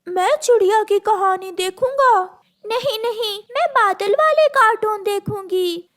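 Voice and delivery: put-on high childish bickering; squabbling kids. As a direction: whine back and forth like siblings. Siblings squabbling over remote.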